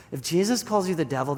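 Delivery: ordinary talk, nothing marked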